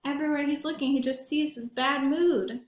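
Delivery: plain reading voice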